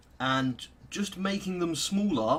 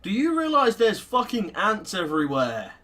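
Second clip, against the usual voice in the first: exasperated retort; 6 semitones higher, 8 dB louder than usual. Real, not acted. Mocking retort to scientist.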